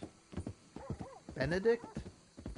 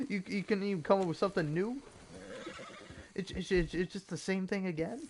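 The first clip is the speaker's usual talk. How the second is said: high-pitched mock indignation; playfully aggrieved, stammering protest; pissed off; 6 semitones higher than usual. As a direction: complain in pretend disbelief, voice up. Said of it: "I'd be so pissed about that".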